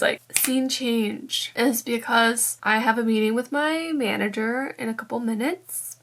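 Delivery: usual speaking voice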